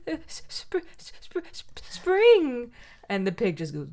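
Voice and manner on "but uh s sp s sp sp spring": high stammer with breathy s-sounds; a cold groundhog stammering to the pig; shivering stammer. Retold or imitated. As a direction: stutter it out like you're freezing. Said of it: "gets very cold".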